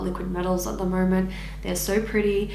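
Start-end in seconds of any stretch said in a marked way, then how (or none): none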